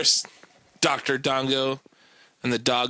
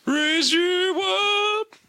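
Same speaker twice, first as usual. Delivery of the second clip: excited echo; slower than usual. Real, not acted. Echoes friend, high.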